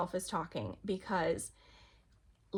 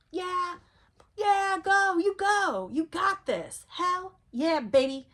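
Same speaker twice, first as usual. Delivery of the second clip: high, loud hype-up cheer; 10 semitones higher, 6 dB louder, slower than usual. Acted. Higher self cheering you from above, excited and high. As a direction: pump them up like a coach.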